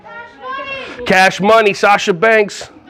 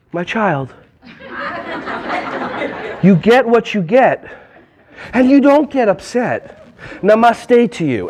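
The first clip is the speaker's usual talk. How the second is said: serene guru calm; slower than usual. Shown as a demonstration. Teacher mocking a zen-calm reply to a child, slow and serene. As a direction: deliver it like a blissed-out guru. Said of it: "Do you say it like this?".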